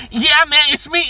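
high, loud mock-gangster reply; hammed-up gangster rapper. Uncle outs himself to nephew, loud high gangster bluster. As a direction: holler it as a pretend rapper.

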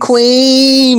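loud, high-pitched fan shout; drawn-out shout of adoration; excited cheer. Real, not acted. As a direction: cheer for your idol. Cheers the named lip sync assassin.

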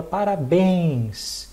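"parabéns" with a long, held "béns"; stress on last syllable. Shown as a demonstration. Teacher illustrating the accent rule, final-stressed "parabéns". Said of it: "A última sílaba é a tônica".

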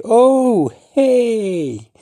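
high, loud surprised oh hey; bright oh hey. Shown as a demonstration. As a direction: spot a friend and call out. Casual greeting demo.

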